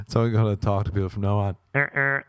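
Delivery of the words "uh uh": short two-note honk; vocal car-horn imitation; car horn noise. Shown as a demonstration. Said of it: "a car horn".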